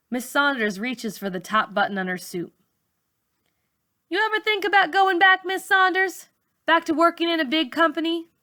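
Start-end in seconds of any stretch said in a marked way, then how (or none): 4.1-8.3 put-on teen girl's voice. A narrator's reading. Malika questions teacher.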